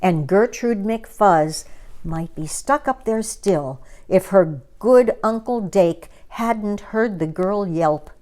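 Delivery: usual speaking voice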